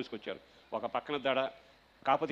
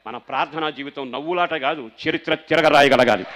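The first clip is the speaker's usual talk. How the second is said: loud, building sermon shout; preaching at full force; 14 dB louder than usual. Acted. Pastor exhorting the congregation, building to a shout. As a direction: build to a roar.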